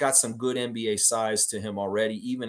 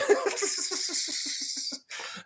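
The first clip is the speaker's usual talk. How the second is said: real laugh; 4 dB quieter than usual. Real, not acted. Laughing at own impression.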